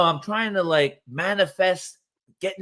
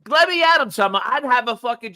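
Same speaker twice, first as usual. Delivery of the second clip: loud; 6 semitones higher, 5 dB louder than usual. Real, not acted. Riled up at the politicians.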